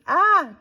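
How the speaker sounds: sudden high loud shriek. A narrator's reading. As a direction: let out a startled shriek. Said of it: "George shrieked".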